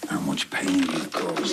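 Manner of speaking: low, breathy threat whispered close; menacing whisper. Acted. Man whispering menace at the guilty vicar. Vicar confronted over harm done.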